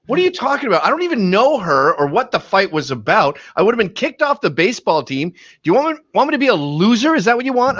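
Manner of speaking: high-pitched defensive protest, imitating his son; mock teenager's outraged rant; indignant teenage son. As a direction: whine back at dad, hammed-up. Acts out son's indignant protest.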